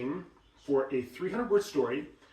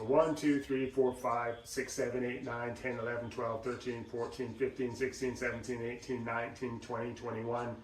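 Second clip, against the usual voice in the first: even, steady count on one breath, softer; controlled counting; 3 semitones lower, 4 dB quieter than usual. Shown as a demonstration. Coach counting on one breath, steady and soft. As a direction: count steadily, spending air evenly. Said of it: "practice counting in the same way".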